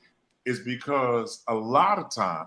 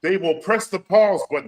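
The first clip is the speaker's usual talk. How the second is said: higher and louder, building the point; rising preacher fervor; fired-up preaching; 5 semitones higher, 5 dB louder than usual. Real, not acted. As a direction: preach it like a pulpit climax. Preaching point, raised and loud.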